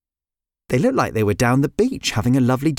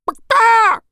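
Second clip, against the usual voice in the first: loud high hen squawk; startled squawk; 6 dB louder than usual. Acted. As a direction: squawk in fright. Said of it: "went Nuala".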